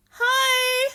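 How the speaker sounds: loud, very high mock hi; exaggerated high-pitched hello; hammed-up awkward greeting. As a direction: squeal a greeting, overdone. Acts awkward greeting beside idol.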